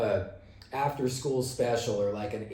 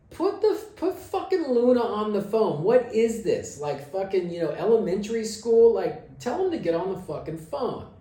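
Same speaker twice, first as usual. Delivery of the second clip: high, loud, exasperated tirade; angry demand; 9 semitones higher, 4 dB louder than usual. Retold or imitated. Yelling at the guitarist to put the singer on, high and loud. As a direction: rant at the messenger in disbelief.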